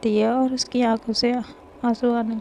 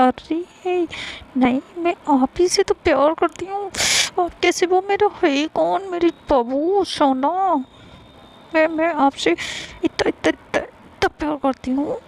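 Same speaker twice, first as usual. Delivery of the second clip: sweet coaxing; 6 semitones higher than usual. Acted. Lovely coaxing her wife sweetly.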